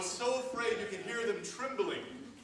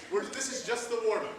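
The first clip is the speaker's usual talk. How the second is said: ordinary talk, nothing marked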